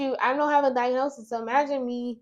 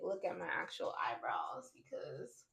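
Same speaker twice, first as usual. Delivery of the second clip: whisper; 4 semitones lower, 17 dB quieter than usual. Real, not acted.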